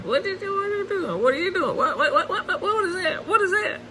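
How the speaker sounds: stammered what-what in amazed giggles; surprised. Real, not acted. Baffled by the hog in the trap, high laughing disbelief. Hog does something strange.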